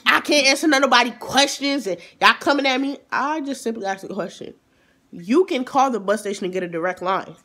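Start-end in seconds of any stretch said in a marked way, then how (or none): 0.1-3.0 loud driver. Retold or imitated. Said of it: "She was just like".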